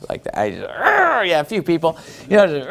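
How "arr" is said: angry teeth growl. Shown as a demonstration. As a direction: snarl through clenched teeth. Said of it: "so mad that you've gritted your teeth".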